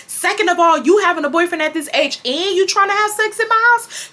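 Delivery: high-pitched indignant mother imitation; hammed-up parental lecture; scolding mom. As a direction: count off offenses in outrage. Retold mother scolding in quarrel.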